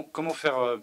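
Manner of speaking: ordinary talk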